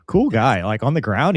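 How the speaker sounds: ordinary delivery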